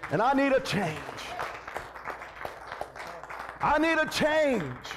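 driving, rhythmic sermon delivery; full-force preaching. Real, not acted. Preacher urging church that they need a change, full-force preaching. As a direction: preach it hard with punched phrases.

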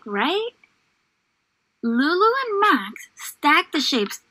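gentle storybook read-aloud; reading to children. Acted. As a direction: read it like a picture book for little ones. Teacher reads story to class.

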